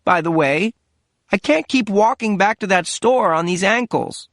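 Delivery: mother scolding her absent son; complaining mother. Acted. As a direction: pile on the guilt, aggrieved.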